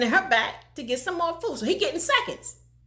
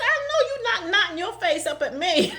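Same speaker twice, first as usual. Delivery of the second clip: laughing retelling; 8 semitones higher, 3 dB louder than usual. Retold or imitated. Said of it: "Ain't I country?".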